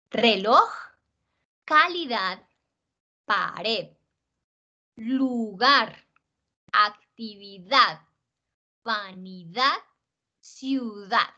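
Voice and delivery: final syllable stretched in each word, as in "relooj, calidaad, ciudaad"; exaggerated final stress. Shown as a demonstration. Teacher showing students where the force falls, exaggerated last-syllable stress on "reloj", "calidad", "pared". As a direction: lean hard and long on the last syllable of "reloj", "lugar", "ciudad". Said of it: "estoy siendo acá un poquito exagerada".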